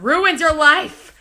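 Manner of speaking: loud, high, slow mock warning; playful loud scolding of viewers. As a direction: hammer the point home. Scolding viewers about phones.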